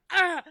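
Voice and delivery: a loud sharp panicked "Ah!"; mock panic scream. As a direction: shriek like your anxiety just spiked. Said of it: "My anxiety goes".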